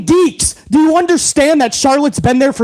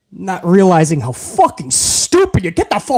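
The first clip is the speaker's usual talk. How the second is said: normal talking voice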